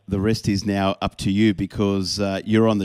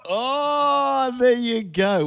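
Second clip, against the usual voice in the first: delighted surprise; 14 semitones higher, much slower than usual. Real, not acted. Amazed at caller knowing Jet.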